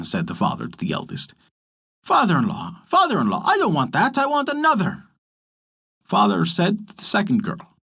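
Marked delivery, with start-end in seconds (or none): 2.0-5.0 lightly voiced frog, rising higher, insisting; a fussy frog pushing back; refusing frog. A narrator's reading. Frog rejects the eldest daughter, lightly voiced refusal.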